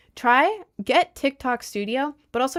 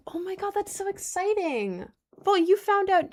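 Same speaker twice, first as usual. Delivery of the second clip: thrilled gushing; 10 semitones higher than usual. Real, not acted. Streamer hearing that a viewer's crush likes them, thrilled gushing.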